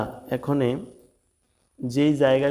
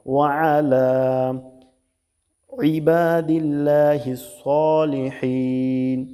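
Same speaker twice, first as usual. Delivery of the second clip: slow recitation; 2 semitones higher, 3 dB louder, much slower than usual. Shown as a demonstration. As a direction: chant it slowly for students to copy.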